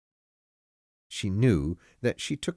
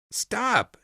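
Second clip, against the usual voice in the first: sharp high cry of stop; shouted; 13 semitones higher than usual. Acted. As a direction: yell it out to halt the driver. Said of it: "shouted Stevie".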